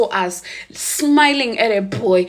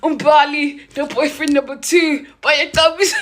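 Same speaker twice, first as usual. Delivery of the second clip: high, loud laughing report; excited gossip; 6 semitones higher, 6 dB louder than usual. Retold or imitated. Reporting the scandal she saw outside Mbali's house, laughing. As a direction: giggle it out like scandal.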